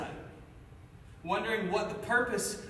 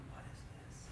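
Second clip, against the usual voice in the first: whispered, breathless surprise; startled whisper; 20 dB quieter than usual. Retold or imitated. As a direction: hush it like a sudden shock. Startled by a hand on his arm.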